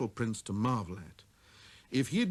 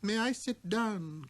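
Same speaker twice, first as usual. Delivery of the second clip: timid; 9 semitones higher, slower than usual. A narrator's reading. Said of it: "a timid inquiry from the little prince".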